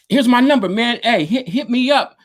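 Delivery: high, bright-eyed pitch of an eager fan; eager offer. Retold or imitated. Fan handing his number to Roger, high and eager.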